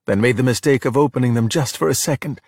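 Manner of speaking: usual speaking voice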